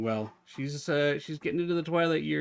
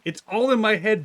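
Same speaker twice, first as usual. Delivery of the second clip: loud, higher teasing protest; loud retort; 6 semitones higher, 9 dB louder than usual. Real, not acted. Streamer loudly protesting to teasing chat. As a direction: fire it back at chat.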